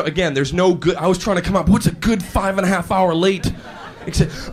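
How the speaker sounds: normal talking voice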